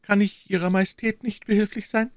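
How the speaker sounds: a tender friend's voice; the maid gently offers help; gentle and caring. A narrator's reading. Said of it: "eine Stimme voll Sanftmut und Teilnahme".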